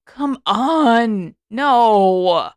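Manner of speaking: loud, exasperated outburst; Sokka's loud frustrated cry; frustrated groan. A narrator's reading. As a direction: let it burst out in frustration. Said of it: "Sokka groaned".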